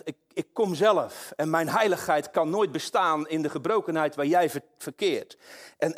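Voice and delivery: preacher voicing God hiding himself in darkness; preaching God's voice. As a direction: let the Almighty proclaim it. Voices the Almighty declaring.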